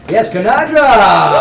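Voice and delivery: slow, loud, stretched name call; hype announcer. Real, not acted. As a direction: welcome her like a game show host. Announcing a guest.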